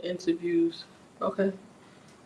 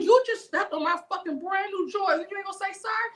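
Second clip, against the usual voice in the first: mock furious; 12 semitones higher than usual. Furious retold confrontation.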